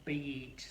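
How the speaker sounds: "beach" with a drawn-out ee; long vowel. Shown as a demonstration. Teacher models the long vowel sound, lengthened. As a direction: hold the ee in "beach". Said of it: "gonna make the sound longer".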